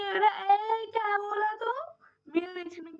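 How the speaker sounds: loud, high, indignant snap at the driver; mock-furious rickshaw row; indignant outburst. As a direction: call him out, offended. Angry retort to rickshaw driver.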